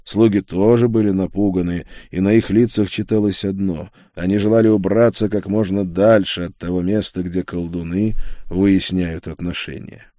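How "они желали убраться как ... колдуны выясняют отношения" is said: ordinary delivery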